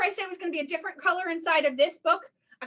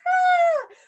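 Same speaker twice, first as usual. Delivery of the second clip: sudden high yelp; high excited cry; 7 dB louder than usual. Acted. Librarian squealing while reaching for the stars. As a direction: squeal as you stretch up.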